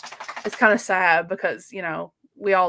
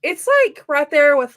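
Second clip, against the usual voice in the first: mock dismay; 6 semitones higher, 9 dB louder than usual. Real, not acted. Comparing ranks, exaggerated dismay.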